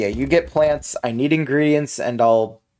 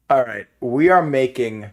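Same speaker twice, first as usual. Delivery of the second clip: ordinary talk, nothing marked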